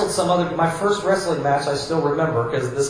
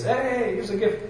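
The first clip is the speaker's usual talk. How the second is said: lightly voiced gift-giver, higher and far-off; mock grandpa; 5 semitones higher, 4 dB quieter than usual. Pastor imitating a grandpa handing kids a gift, in a lightly put-on voice.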